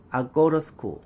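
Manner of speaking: "아꼬러스쿠" run together as one word; "I go to school" reduced to "ah-go-ruh-skool". Shown as a demonstration. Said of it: "같이 읽어보실까요". ("아꼬러스쿠"로 한 단어처럼 이어서)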